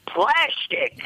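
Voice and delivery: high, screeching comic character voice; Gilbert Gottfried. Retold or imitated. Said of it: "he's gotta do that fucking voice".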